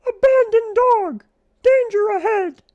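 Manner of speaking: very high, loud squeaky alarm cry; tick's alarm. A narrator's reading. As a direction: yell the warning as a tiny bug. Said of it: "called a tick as it fled".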